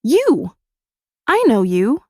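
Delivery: high loud accusing cry; startled recognition. A narrator's reading. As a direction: blurt it out in shock. Sudden recognition, accusing gasp.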